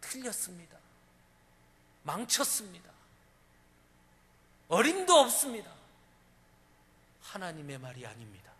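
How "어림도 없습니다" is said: a loud, emphatic outburst; shouted preaching emphasis; forceful rejection. Real, not acted. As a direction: slam the door on the idea. Preacher rejecting wrong speech.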